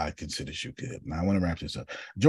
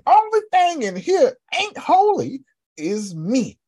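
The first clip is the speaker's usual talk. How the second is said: dramatic lament; 10 semitones higher than usual. Acted. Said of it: "Isaiah said".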